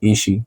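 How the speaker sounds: "is she" said as one linked "ishee"; silent S. Shown as a demonstration. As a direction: drop the S in "is she", leaving "ee-shee". («is she» روان و بدون S، مثل «ایشی»)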